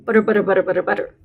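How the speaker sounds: butter said over and over quickly; repeated drill. Shown as a demonstration. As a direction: rattle off butter again and again. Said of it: "say that a whole bunch of times".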